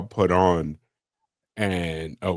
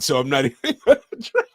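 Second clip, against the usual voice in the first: usual speaking voice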